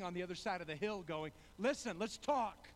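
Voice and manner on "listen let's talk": calling across. Retold or imitated. David calls across the hill.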